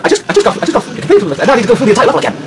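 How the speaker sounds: very rapid, stumbling rant; fast-forward babble with restarts; fast motion. Pretend. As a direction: race through the complaint at double speed. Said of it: "In fast motion".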